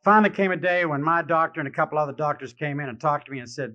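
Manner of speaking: ordinary talk, nothing marked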